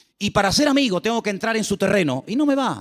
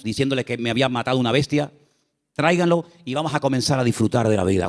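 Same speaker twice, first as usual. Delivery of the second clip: José, lower; 8 semitones lower than usual. Acted. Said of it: "le dice".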